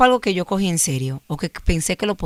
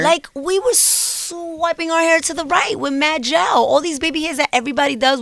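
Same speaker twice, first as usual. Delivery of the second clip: New York swagger; 11 semitones higher than usual. Real, not acted. Proud New York girl swagger.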